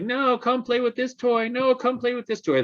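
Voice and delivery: put-on high, whiny child voice; whining child. Child whining at the parent during play, high and whiny. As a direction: whine it like a clingy kid. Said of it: "like, whining".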